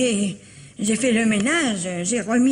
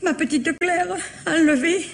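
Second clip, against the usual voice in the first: tearful; 5 semitones higher than usual. Acted. Aunt learns niece was kidnapped.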